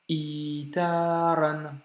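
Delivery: slow "itaran" with long vowels; blocked i, long i. Shown as a demonstration. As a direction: start "itaran" on a caught i and hold it. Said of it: "On doit bloquer i".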